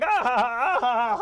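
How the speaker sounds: loud, high staccato laughing; cartoon cackle. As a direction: burst into a hammed-up cartoon cackle. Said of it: "Squidward's just laughing".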